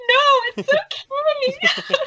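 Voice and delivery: very high, drawn-out wail of sympathy; gushing, hammed-up high-pitched reassurance; consoling Meredith. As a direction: squeal it slowly, smothering them with comfort. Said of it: "she flings her arms around you and she's like".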